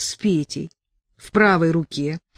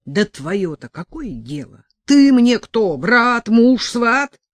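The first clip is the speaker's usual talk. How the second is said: higher and louder, snapping; angry retort; 6 semitones higher, 8 dB louder, slower than usual. A narrator's reading. Sasha snapping at Petka who he is to her, loud and angry. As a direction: snap back contemptuously.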